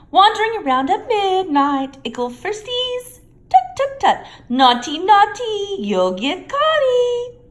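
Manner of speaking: high, slow, teasing sing-song; mocking poltergeist. A narrator's reading. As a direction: taunt them like a gleeful imp. Peeves voice.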